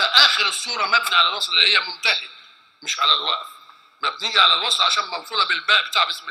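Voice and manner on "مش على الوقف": ordinary talk, nothing marked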